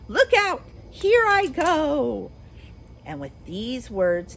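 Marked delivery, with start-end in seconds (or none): 0.1-2.3 high, loud, slow last shriek; witch's final cry. Acted. Melting witch calls out as she collapses, high and loud. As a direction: shriek as you vanish.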